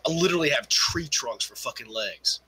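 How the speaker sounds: normal talking voice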